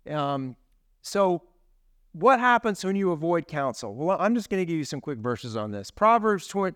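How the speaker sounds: ordinary talk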